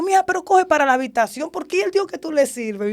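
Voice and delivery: imitating an exasperated mother, high-pitched; mother losing patience. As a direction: send your daughter off to her room. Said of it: "mi mamá con su paciencia".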